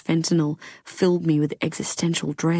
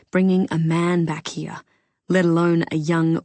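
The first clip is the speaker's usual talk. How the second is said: ordinary delivery, nothing marked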